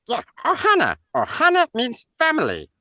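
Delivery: high, alien cartoon voice; squeaky little alien creature; Stitch voice. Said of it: "can you?".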